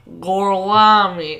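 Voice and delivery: slow, loud Tennessee drawl on Italian; thick Tennessee accent. Retold or imitated. Host imitating a fake Italian introducing himself, Tennessee drawl. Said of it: "with his thick Tennessee accent".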